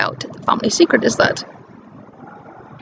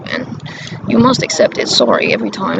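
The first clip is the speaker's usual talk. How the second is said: ordinary talk, nothing marked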